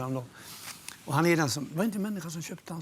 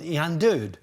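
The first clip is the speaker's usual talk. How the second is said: louder mock call after a departing friend; half-joking louder call; 7 dB louder, slower than usual. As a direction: shout the question down the stairs. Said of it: "så ropade han".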